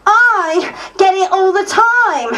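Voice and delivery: loud repeated accusation; shouting. Retold or imitated. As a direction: hurl it at the MP. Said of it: "says Tracy".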